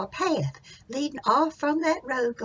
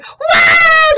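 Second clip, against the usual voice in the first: high, drawn-out robber scream; long loud howl of alarm; terrified yell; 20 dB louder than usual. Acted. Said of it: "that robber yelled".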